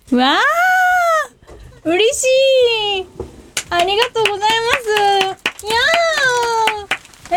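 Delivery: high delighted squeal; excited squeal. Real, not acted. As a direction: gush with real delight. Surprise flowers revealed. (花に大喜びして高い声で)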